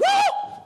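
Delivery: very high, loud yelp of fright; hammed-up freak-out shriek; panicked mock scream. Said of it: "You freak out like".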